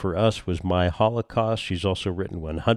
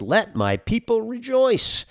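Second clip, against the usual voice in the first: higher, slow, grand prophet voice; Moses voice; 13 semitones higher than usual. Retold or imitated. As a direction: proclaim it like Moses. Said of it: "As Moses said".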